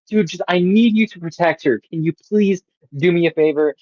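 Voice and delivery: exasperated mock plea; pleading. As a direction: imitate someone begging a favor. Acted plea in an argument.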